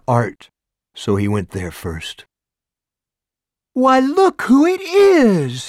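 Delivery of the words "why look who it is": put-on high, slow, gushing old busybody; sugary high busybody voice; gushing neighbor. A narrator's reading. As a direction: coo it out like a nosy neighbor thrilled to see him. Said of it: "she cried when she opened the door".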